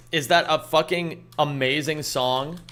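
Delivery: usual speaking voice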